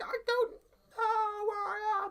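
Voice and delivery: high, slow, loud wail; hammed-up lost-and-desperate cry; mock shouting. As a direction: wail helplessly in comic falsetto. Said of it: "Why are you shouting at me".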